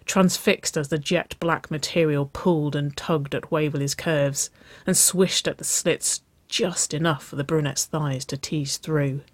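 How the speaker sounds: ordinary delivery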